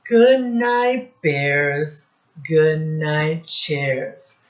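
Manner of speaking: loud, sing-song storybook reading; reading to children. Acted. Teacher reading Goodnight Moon aloud, sing-song. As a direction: read each goodnight out to the class. Reading picture book to class.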